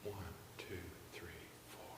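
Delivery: whispered count; soft whisper. Shown as a demonstration. Conductor dropping to a soft beat, whispering the count. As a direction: breathe the numbers out. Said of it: "Now soft".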